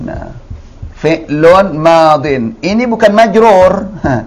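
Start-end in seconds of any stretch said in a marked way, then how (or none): 1.0-2.6 fi'lun madin read very slowly, each syllable clear; teacher models fi'lun madin slowly. Shown as a demonstration. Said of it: "jangan dikirain majrur".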